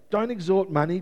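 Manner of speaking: ordinary talk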